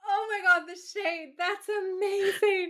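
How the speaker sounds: high-pitched, gleeful astonishment; real delighted amazement. Real, not acted. Co-host hearing the catty fun fact, delighted and amazed. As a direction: gasp it out high, relishing the gossip.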